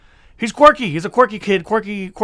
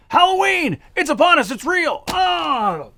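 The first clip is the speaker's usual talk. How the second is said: excited Halloween cry; 10 semitones higher, slower than usual. Acted. Spooky intro shout.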